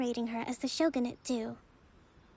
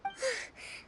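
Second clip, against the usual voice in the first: long weary exhale, breathy and falling; tired sigh; 10 semitones higher, 8 dB quieter than usual. Acted. Leader overwhelmed by the crowd's questions, sighing. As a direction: let the pressure out in one sigh. Swarmed by petitioners' queries.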